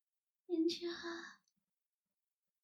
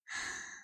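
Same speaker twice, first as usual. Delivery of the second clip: soft gasp; 6 dB quieter than usual. Acted. Delighted at prisoner's hometown.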